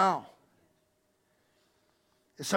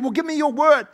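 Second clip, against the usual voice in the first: high, loud demanding plea; pushy mother; 9 semitones higher, 10 dB louder than usual. Retold or imitated. Mother demanding Jesus promise her sons honour, pushy. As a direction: press him hard for a promise. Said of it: "She said".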